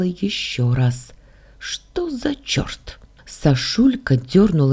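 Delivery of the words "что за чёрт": slow, soundless whisper; high, barely audible whisper; voiceless attempt. A narrator's reading. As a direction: try to speak with no voice left. Heroine tries speaking with lost voice.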